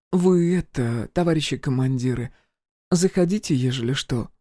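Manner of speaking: tossed over the shoulder, a little coy; coy village woman. Acted. Woman parting remark, voiced.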